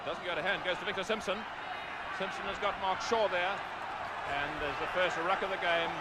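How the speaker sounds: rugby commentator following the ball, voice up and pressing; live play-by-play. Real, not acted. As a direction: call the ruck as it unfolds, urgent.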